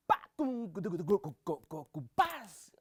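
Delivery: ordinary talk, nothing marked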